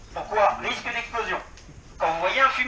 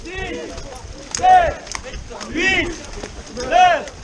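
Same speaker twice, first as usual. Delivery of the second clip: loud, higher counting out; shouted count; 5 semitones higher, 4 dB louder, much slower than usual. Real, not acted. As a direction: bark out each number. Counting exercises aloud together.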